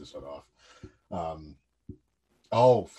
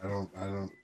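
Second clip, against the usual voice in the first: ordinary talk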